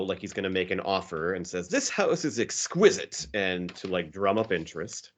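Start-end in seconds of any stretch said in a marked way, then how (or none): 1.7-3.3 high, drawn-out put-on gushing; showy admiration. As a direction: put on a show as an impressed house buyer. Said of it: "puts on a show".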